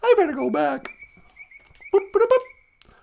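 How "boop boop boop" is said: high, bright beeping sounds; mock beeping. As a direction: beep like a gadget. Imitating device noise.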